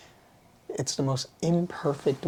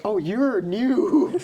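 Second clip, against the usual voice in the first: slow, higher, louder coo; cooing at baby; 9 semitones higher, 6 dB louder, much slower than usual. Retold or imitated. Meeting a baby soul, cooing slow and high.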